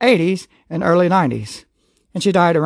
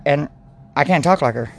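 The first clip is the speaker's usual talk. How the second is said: ordinary delivery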